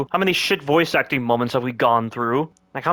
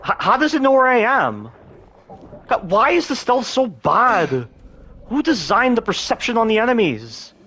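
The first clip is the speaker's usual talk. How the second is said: high, slowed disbelieving complaint; exasperated; 9 semitones higher than usual. Real, not acted. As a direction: plead with the game in frustration. Stealth failing again.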